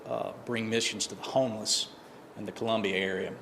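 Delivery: ordinary talk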